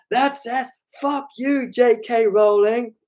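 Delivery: high-pitched retold shout; shouting over music. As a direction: re-enact straining to be heard over loud music. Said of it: "I really had to shout over the music".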